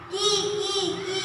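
high little bird cry; bird chirp. Shown as a demonstration. Teacher shows the class the baby bird's cry, high chirps. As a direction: chirp like a small crying bird. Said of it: "എന്ത് ശബ്ദം ഉണ്ടാക്കിയത്?".

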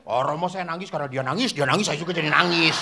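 put-on fast, rattled-off boy's answer; imitated schoolboy. Boy explains to priest why he cried, rapid imitation. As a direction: blurt the excuse in a rush. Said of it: "laki-laki saya tanya duluan".

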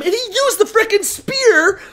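high-pitched rising incredulous excitement; excited amazement. Real, not acted. Co-host marvelling at the spear fight, excited and high. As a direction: climb high as the feat gets wilder.

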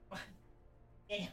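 normal talking voice

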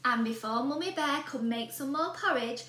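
ordinary delivery, nothing marked

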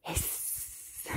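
soft, voiceless snake hiss. Shown as a demonstration. Answering the snake question, soft hiss. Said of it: "What does a snake say?".

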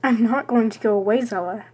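normal talking voice

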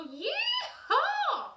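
very high, loud yeehaw with a laugh. Shown as a demonstration. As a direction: whoop it like a happy cowboy. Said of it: "I'll go like this".

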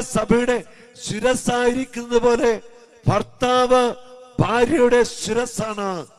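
loud, high and slow, ringing out the verse; slow, loud pulpit proclamation; full-force preaching. Acted. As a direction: proclaim it to the whole church. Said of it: "preached scripture line".

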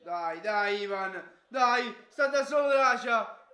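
loud urgent cheering; shouting. Real, not acted. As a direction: urge him on, rising. Fan urging player.

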